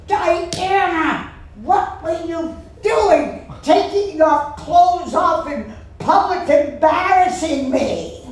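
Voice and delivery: mock outraged mom scolding her daughter; scolding mother. Mother scolding daughter after show.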